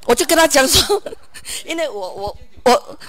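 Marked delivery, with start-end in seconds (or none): none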